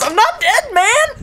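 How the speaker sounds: high-pitched cartoon protest; indignant mock protest. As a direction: play an offended character objecting. Voices indignant Tetsuya protesting death.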